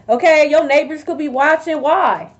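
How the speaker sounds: ordinary talk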